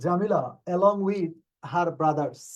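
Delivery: slow, deliberate dictation pace; slow reading. Shown as a demonstration. Teacher reading question ten slowly to the class. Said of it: "teacher reads question slowly".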